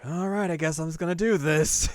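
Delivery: fine, doing it alone; resigned. Retold or imitated. Yu alone in the kitchen, resigned.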